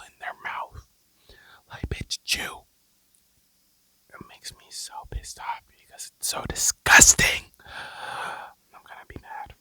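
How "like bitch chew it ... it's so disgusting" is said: loud exasperated rant breaking the whisper; angry outburst. Real, not acted. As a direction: snap at it, fed up. Ranting about loud chewers.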